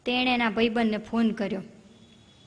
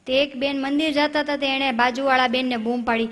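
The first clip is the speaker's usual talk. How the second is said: ordinary delivery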